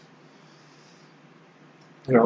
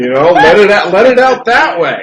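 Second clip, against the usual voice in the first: laughing; 5 semitones higher, 6 dB louder than usual. Real, not acted. Joking about rough sex, laughing.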